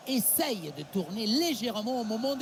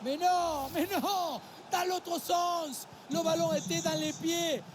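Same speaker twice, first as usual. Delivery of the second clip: exasperated shout; 7 semitones higher than usual. Real, not acted. Co-commentator dismayed at defending.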